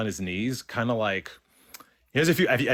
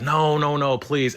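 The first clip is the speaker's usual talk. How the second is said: higher, louder retold protest; insistent "no", pitched up; pleading refusal; 6 semitones higher, 5 dB louder, slower than usual. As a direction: wave the offer away insistently. Tearfully refuses offered money.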